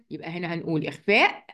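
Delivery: normal talking voice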